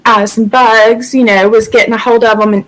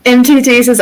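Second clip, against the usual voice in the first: ordinary delivery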